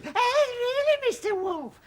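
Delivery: high piggy puppet voice. Acted. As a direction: squeak it like a cheeky pig. Voicing pig in the play.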